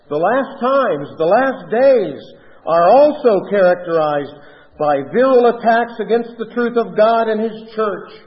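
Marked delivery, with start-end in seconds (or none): 2.7-4.4 "also" punched louder; stress on also. Real, not acted. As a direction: lean firmly on the word "also". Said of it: "I emphasize that word also".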